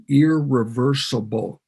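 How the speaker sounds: "irreversible" drawn out slowly, syllable by syllable; slow, emphatic "irreversible"; stress on irreversible. Real, not acted. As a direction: lean hard on "irreversible", stretching it. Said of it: "I wanna emphasize the word".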